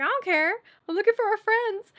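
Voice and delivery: high-pitched mock protest; defiant retort. Acts out defiant reply to starers.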